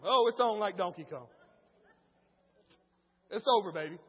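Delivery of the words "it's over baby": ordinary talk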